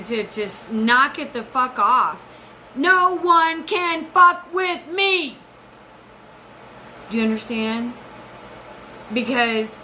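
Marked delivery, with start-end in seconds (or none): 2.7-5.4 threatening spirit. Retold or imitated. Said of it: "she's threatening him".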